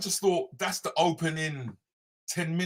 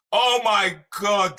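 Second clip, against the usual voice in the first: excited; 8 semitones higher, 7 dB louder, much slower than usual. Real, not acted. Greeting a close friend.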